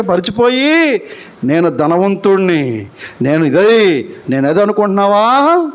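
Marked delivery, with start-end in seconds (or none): 1.4-4.6 mocking boast. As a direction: mimic a self-satisfied rich man, deep and unhurried. Mocking the self-satisfied rich man.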